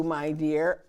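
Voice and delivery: ordinary delivery, nothing marked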